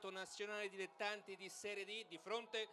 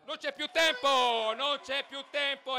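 excited final whistle call; 5 semitones higher, 14 dB louder than usual. Real, not acted.

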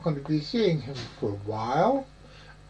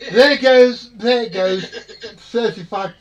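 laughing; 5 semitones higher, 10 dB louder, slower than usual. Real, not acted. Laughing at the accent joke.

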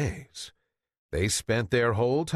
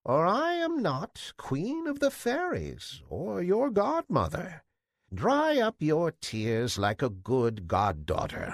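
fairy godmother; 8 semitones higher than usual. Acted. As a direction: promise it like a queen of fairies. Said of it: "said the old woman".

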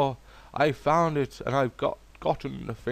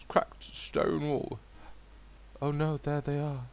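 ordinary talk, nothing marked

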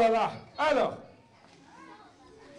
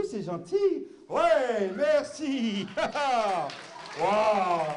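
normal talking voice

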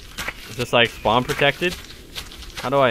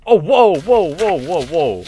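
startled whoa run; 7 dB louder, slower than usual. Real, not acted. Startled by in-game voice.

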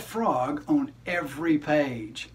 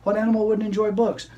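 normal talking voice

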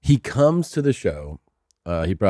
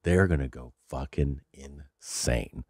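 hushed prediction; 8 semitones lower, 11 dB quieter, slower than usual. Retold or imitated. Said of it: "I go".

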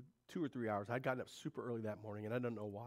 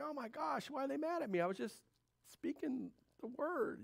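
mock bewildered; 14 semitones higher than usual. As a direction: play the puzzled, hurt preacher. Voicing his own hurt thought.

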